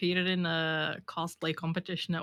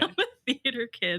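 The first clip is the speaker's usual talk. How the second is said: higher laughing proud claim; laughing declaration; 5 semitones higher than usual. Real, not acted. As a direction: announce it with a laugh. Proud theatrical confession laughing.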